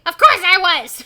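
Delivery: very loud, high professor snap; snapping. A narrator's reading. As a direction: snap back at full volume. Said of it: "Professor Shock snaps".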